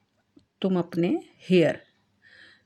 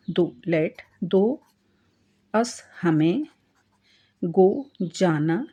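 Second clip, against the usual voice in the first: ordinary delivery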